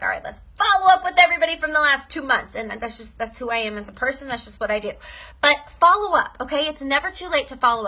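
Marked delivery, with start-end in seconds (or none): 0.0-2.5 fast, louder pep to herself; fired-up burst. Retold or imitated. Deciding to follow up with everyone, a fast pumped-up burst. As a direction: rattle it off all pumped up. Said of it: "I get on these bursts where I'm like".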